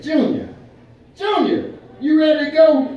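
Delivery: loud high call to the grandson. Retold or imitated. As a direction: call him from the car door. Calling grandson in hot car.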